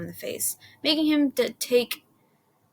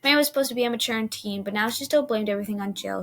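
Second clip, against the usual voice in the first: plain reading voice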